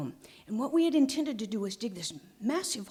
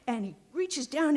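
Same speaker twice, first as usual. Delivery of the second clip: usual speaking voice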